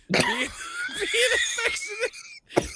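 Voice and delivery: high, slow, shouted through laughter; laughing while reading. Real, not acted. Host reading a quote aloud while laughing hard. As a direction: read it while cracking up.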